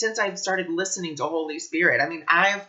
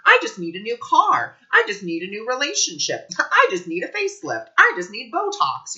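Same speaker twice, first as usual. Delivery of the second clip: higher and louder, lightly mocking craving; mock whiny wanting ego; 6 semitones higher, 4 dB louder than usual. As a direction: parody the grasping ego, a touch hammed-up. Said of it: "we're thinking with the ego".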